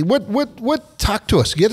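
high, eager urging to spill the secret; eager prodding. Real, not acted. Host urging Ricky to share the secret, high and eager. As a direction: push him playfully, voice jumping up.

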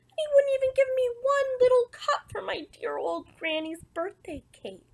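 high-pitched, put-upon protest; aggrieved complaint. Acted. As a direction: plead your grievance in a high voice. Aggrieved wolf complains of refusal.

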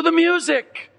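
high, fervent preacher's cry; preaching at full force. Acted. Preacher warning the congregation about music: fervent high cry.

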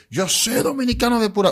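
high, loud mimicked objection; mock protest. Mimics people who would object. (protesta imitada, aguda y fuerte)

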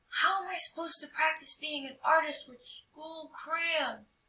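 put-on boy complaining. Tommy complaining.

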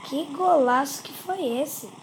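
normal talking voice

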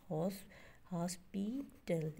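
हॉस्पिटल slowly in three separate beats; stretched teacher's syllable count on हॉस्पिटल. Shown as a demonstration. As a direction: break हॉस्पिटल into हॉस, पि, टल. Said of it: "इसके three syllables होंगे".

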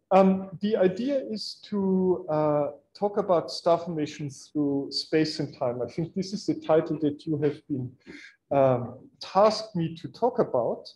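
ordinary talk

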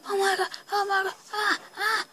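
high, loud mock terror with cries; hammed-up fright. Kid pretending to be scared of his own effect, hammed-up shrieks. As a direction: play at being terrified, squealing.

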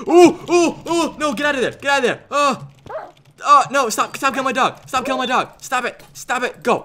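high, urgent pleading; panicked. Real, not acted. Player watching his dog get attacked, panicked. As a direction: cry out to save your pet.

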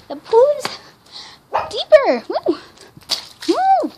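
high squealing woo; excited cheering. Real, not acted. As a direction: squeal with joy. Cheering the deeper pool.

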